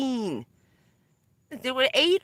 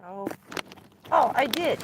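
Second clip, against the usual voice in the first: ordinary talk, nothing marked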